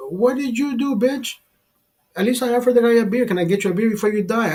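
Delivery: high-pitched, hurried protest; indignant comeback. Retold or imitated. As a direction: throw the blame back in a retold argument. Retold quarrel with bystanders.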